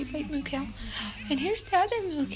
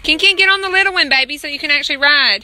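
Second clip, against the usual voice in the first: calling out; 2 semitones higher, 11 dB louder than usual. Real, not acted. Calling to kid on ride.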